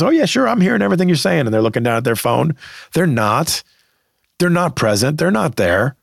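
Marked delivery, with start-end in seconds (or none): 0.0-1.5 high-pitched, mock attentive reply; breezy fake reassurance, pitched well up; distracted assurance. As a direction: imitate someone half-listening while on their phone. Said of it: "manage that a little bit while they're like".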